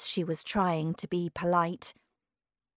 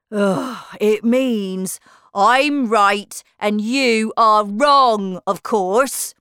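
loud, slow, pompous explanation; groan, then spell it out slowly and loudly; exasperated arrogance; 3 semitones higher, 10 dB louder, much slower than usual. Acted. Humpty Dumpty telling Alice she is wrong, exasperated and slow.

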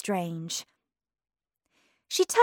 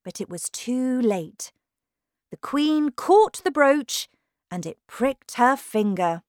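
ordinary delivery

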